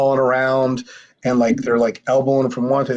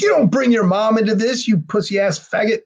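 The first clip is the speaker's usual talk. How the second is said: higher-pitched imitation of jeering guys; pitched-up mock outrage; 8 semitones higher than usual. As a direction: heckle him, retelling it. Group jeers at Chad's excuse.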